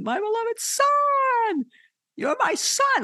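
very high, drawn-out mock yell; God yelling from heaven. Preacher acting God proclaiming his son at the baptism, high drawn-out yell. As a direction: call it down from on high, stretching "Son". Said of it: "that God yelled out of heaven".

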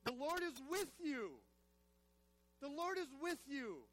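slow, loud preaching declaration; emphatic proclamation. Real, not acted. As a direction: proclaim it slowly to the whole congregation.

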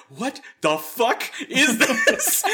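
very slow, laughing and incredulous; exasperated laugh. Retold or imitated. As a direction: stretch it out, laughing at the absurdity. Retold second call center failure.

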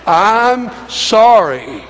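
slow, drawn-out I'm sorry, said firmly; slow, out loud. Shown as a demonstration. Pastor urging Tim MacArthur to say it aloud, drawn out. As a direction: stretch I'm sorry out and mean it. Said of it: "say it out loud".

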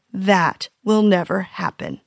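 ordinary talk

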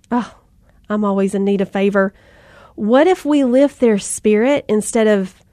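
ordinary talk, nothing marked

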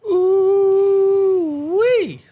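high whooping ooh-wee; excited whoop. Real, not acted. Co-host kicks off the segment with a whoop. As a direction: let out a hype whoop. Co-host's wild hype call.

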